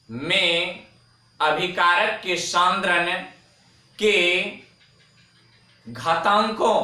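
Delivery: phrase by phrase, pausing between chunks; slow dictation. Shown as a demonstration. Teacher repeating the definition for note-takers, slow dictation. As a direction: repeat it slowly for people writing it down. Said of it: "धीमे धीमे बोल रहा हूं".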